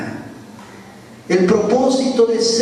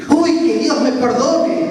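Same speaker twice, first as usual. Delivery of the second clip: mildly acted alarm, high and slow; dismayed apology; 7 semitones higher, slower than usual. Robber learns his victim is a pastor, acted dismay. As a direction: stretch the opening cry in mock dismay.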